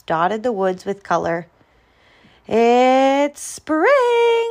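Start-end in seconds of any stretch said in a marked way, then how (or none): 2.5-4.5 high, loud, sustained cry; joyful. A narrator's reading. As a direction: sing out with delight. Groundhog greets spring on waking.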